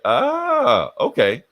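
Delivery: high, drawn-out knowing "ah"; dawning realisation. Acted. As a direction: let the penny drop, hammed up. [name] catches on to the wink.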